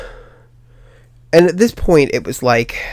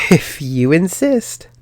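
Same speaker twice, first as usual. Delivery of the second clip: laughing; 4 semitones higher, much slower than usual. Retold or imitated. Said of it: "And I'm like".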